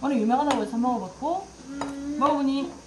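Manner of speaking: talking with food in mouth; speaking between bites; chewing while talking. Real, not acted. Seen 3: chopsticks at lips, mouth working on food throughout the clip.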